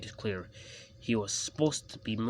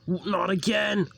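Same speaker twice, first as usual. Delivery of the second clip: high, loud, slow dismay; crying out; 11 semitones higher, 8 dB louder, slower than usual. A narrator's reading. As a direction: wail it in exasperation. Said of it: "cried his driver".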